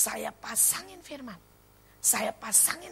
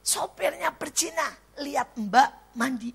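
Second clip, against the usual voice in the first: raised, mimicked child's outcry; imitated child's shout; 6 semitones higher, 4 dB louder than usual. Child shouting about the driver, imitated yell. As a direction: act out a child's shouted accusation. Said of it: "Dan dia teriak".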